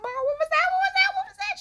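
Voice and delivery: very high, rapid-fire mock panic; frightened kid pestering mom, hammed up; panicked kid. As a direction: squeal it at mom in mounting alarm.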